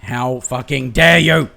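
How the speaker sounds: loud, slow, dragged-out shout leaning on dare; roaring outrage at the game; furious shout. Real, not acted. Streamer raging at sticky keys popping up, furious shout.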